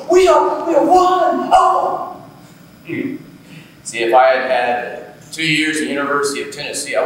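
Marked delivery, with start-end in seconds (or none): 0.1-2.2 very high, loud mock scream; retold screaming. Girlfriend at two thirty, very high mock screaming. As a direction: shriek it like the screamer he describes. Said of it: "she was a screamer, kept going".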